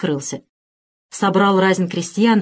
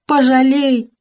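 thin wailing; 6 semitones higher, 4 dB louder, slower than usual. Acted. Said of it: "заплакал он тонко, пронзительно, по бабье".

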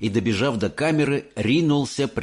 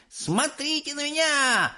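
put-on high, thrilled kid shouting; boy yelling; 4 dB quieter than usual. A narrator's reading. Mike yelling to all as he runs, thrilled. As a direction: show off to everyone. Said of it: "вопил он".

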